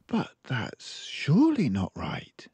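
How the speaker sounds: lightly voiced librarian frowning; concerned librarian. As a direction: librarian mildly worried for the child.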